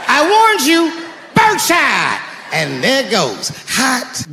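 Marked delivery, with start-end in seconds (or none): 0.1-2.2 higher shouted threat; shouted warning. Acted. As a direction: yell it as you fire. Firing shotgun at intruder.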